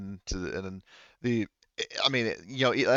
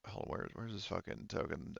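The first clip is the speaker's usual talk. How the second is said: much softer, talking to himself; muttered aside; 14 dB quieter than usual. Real, not acted. Game master hunting for a misplaced token, muttered aside. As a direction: mutter it under your breath while searching.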